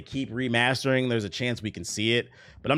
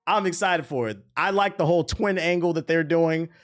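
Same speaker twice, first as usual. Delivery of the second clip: raised, laughing fan enthusiasm; excited; 6 semitones higher, 4 dB louder than usual. Real, not acted. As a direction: gush about your favorite cartoon, pitched up. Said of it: "I am excited for this shit".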